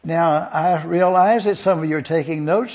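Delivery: ordinary talk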